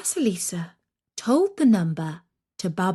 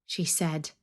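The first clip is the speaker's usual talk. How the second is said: ordinary delivery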